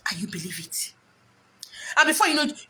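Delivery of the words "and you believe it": hushed whisper, much softer. Shown as a demonstration. Speaker mocking gullible listeners, whispered. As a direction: drop to a secret whisper. Repeats line, then whispers it.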